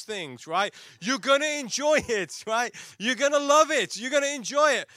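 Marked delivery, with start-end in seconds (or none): 1.0-2.7 high, loud insistence; mock-forceful parental pep talk; pushy parent. Parents forcing child to have fun. 3.0-4.9 high, loud insistence; mock-forceful parental pep talk; pushy parent. Parents forcing child to have fun.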